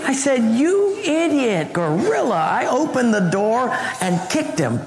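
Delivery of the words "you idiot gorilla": high, slow mock outburst at the roommate; hammed-up scolding, drawn out; mock angry insult. Angry student yelling at roommate flooding his room, high slow mock insult.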